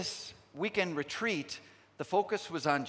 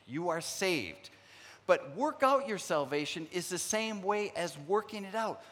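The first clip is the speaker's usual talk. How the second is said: ordinary delivery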